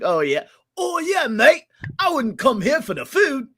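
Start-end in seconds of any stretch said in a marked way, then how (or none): 0.8-1.6 very high, loud British caricature; mock British accent. Host imitating someone from the UK, mock British accent. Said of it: "if you ask anybody from the U K". 2.0-3.5 very high, loud British caricature; mock British accent. Host imitating someone from the UK, mock British accent. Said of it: "anybody from the U K, they're like".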